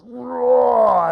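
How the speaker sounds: long, high, loud lion roar. Acted. Trapped lion calling for help, long loud roar. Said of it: "the lion roars".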